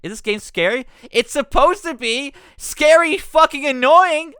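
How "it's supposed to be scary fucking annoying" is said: higher, louder, slow and drawn; real annoyed sarcasm; sarcastic outburst. Real, not acted. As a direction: sneer at the game's promise. Mocking the game's promise.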